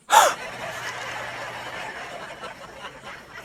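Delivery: high, sharp shocked gasp. Acted. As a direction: gasp in horror. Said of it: "And he gasped".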